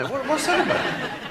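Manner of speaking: slow, high-pitched bafflement; Nicholas Lyndhurst. Retold or imitated. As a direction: draw out the aggrieved question up high. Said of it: "Nicholas was saying".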